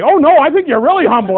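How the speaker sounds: pushy boss not taking no; boss insisting. Retold or imitated. Boss insisting in retold argument.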